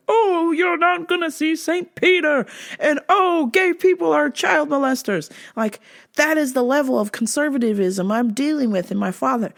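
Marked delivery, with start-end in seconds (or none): 0.1-5.3 offended dad. Retold or imitated. Conservative dad offended at coming out, mocking high-pitched dismay. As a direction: wail it like a scandalized father. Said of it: "It offended him when I came out".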